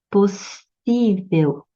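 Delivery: "possível" bem devagar, com o SÍ alongado; sílaba tônica destacada. Shown as a demonstration. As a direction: estique o SÍ de "possível". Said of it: "paroxítona". ("possível" escandido, com peso no SÍ)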